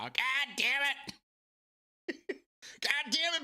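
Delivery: a hammed-up raging gamer friend, mostly laughing; imitating Bubba. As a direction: squeal out his fury through laughter. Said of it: "I can just hear his voice right now".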